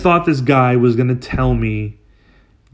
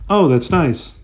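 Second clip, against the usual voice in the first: flat polite; 4 semitones higher than usual. Retold or imitated. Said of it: "Just like that".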